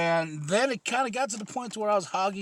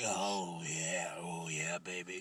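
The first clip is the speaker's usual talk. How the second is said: deep sleazy voice; 12 semitones lower, 12 dB quieter, slower than usual. Retold or imitated. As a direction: purr it low like a lounge lizard.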